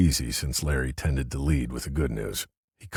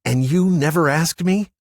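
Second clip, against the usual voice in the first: sharp, higher, louder retort; snapped; 13 semitones higher, 8 dB louder than usual. Acted. Larry snapping at Paul across the table, sharp. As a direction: bite the question off. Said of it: "he snapped".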